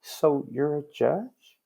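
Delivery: very slow drawn-out disbelief; doubtful question. Retold or imitated. Bailiff doubting the badge, very slow incredulous. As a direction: stretch it, can't believe it. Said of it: "he's gonna actually ask me".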